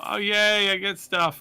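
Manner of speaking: gleeful at the new loot; delighted opening a present. Real, not acted.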